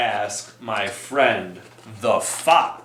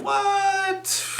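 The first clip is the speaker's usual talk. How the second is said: high squeaky what; mock shock, way up high; surprised. Surprised reaction in song.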